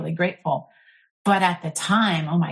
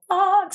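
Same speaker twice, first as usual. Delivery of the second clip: release cry; 13 semitones higher than usual. Retold or imitated. Anger purging out in blessing.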